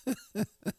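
giggle. Real, not acted. Host excited to be back behind the microphone, giggling. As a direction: giggle helplessly in short high bursts. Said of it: "I am giggling".

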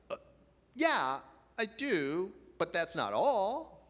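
put-on a touch of a hesitant child; child's hesitant reply.

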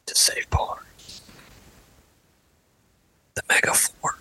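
hushed whispered golf broadcast call; golf commentator. Pretend. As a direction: whisper it like a TV golf announcer at the green.

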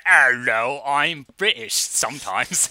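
hammed-up fake British accent, slow and stagey; mock a deliberately awful British accent; bad British accent. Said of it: "the accent he's putting on is very, very bad".

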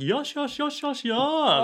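high-pitched, drawn-out Japanese-style praise; squeaky repeated encouragement; excited cooing. Acted. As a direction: coo over a pet in a high voice. Imitating Japanese petting praise.

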